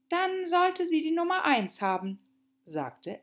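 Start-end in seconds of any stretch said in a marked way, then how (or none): 0.1-2.2 put-on young girl voice. Alice voiced by narrator.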